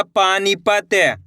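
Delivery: Panipat slow, paa-neee-pa-t; sounded out slowly. Shown as a demonstration. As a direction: stretch every part of Panipat. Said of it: "एक अक्षर का उच्चारण करके".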